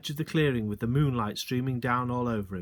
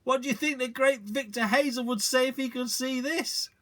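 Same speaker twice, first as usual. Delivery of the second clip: gleeful father; 11 semitones higher than usual. A narrator's reading. Father gleeful, far timbre.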